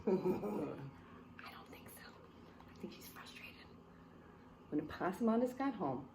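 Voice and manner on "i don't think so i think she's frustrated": whispered aside. Real, not acted. Aside to listeners.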